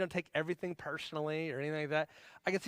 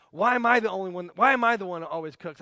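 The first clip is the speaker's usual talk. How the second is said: loud, exasperated imitation of a wife; mock angry yell; 3 semitones higher, 8 dB louder than usual. Wife cooking dinner turns round on her husband, mock angry yell. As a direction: act out the wife snapping back loudly. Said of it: "She turns around and yells at me".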